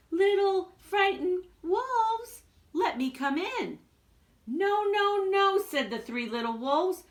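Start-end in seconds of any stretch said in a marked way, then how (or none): 0.1-3.8 high, slow, sly sing-song; coaxing big bad pig. Pig sweet-talks the wolves at the door, slow and sly. 4.5-5.6 high, squeaky little wolves; squeaky wolves refusing. Acted. Little wolves squeak their refusal to the pig.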